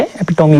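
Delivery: एपिटोमी with "mee" as its last syllable; correct alternative pronunciation. Shown as a demonstration. Teacher confirming to students a valid pronunciation, एपिटोमी ending in "mee". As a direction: sound the final "ee" in एपिटोमी. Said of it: "सही pronunciation है".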